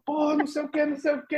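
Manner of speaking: high, hushed garbled complaint; baker muttering. Retold or imitated. Baker woman grumbling at the au pair: high, soft mumble.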